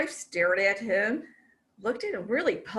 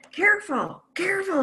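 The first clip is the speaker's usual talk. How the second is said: high, fussy, panicky husband voice; frantic husband; 9 semitones higher, 3 dB louder, faster than usual. Retold or imitated. Husband fussing at wife over frying eggs, high and panicky. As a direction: fret over the pan like a nervous husband. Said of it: "he says".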